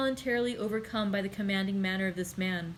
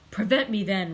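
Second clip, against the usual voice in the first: defiant cry; 7 dB louder than usual. Acted. Struggling against the Count's grip.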